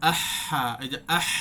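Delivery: আহা with the হ held for one alif length; আহা drawn out evenly. Shown as a demonstration. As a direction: stretch the breathy হ in আহা. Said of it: "এই যে ধরে রাখাটা".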